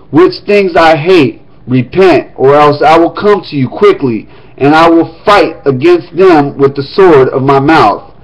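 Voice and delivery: formal reading of Revelation; scripture reading. Acted. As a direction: read the letter aloud to the congregation. Reads letter to Pergamos.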